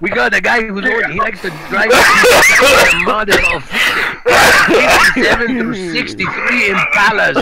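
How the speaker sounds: hammy Chicano lowrider guy; Paco Chicano accent. Pretend. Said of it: "this is Paco".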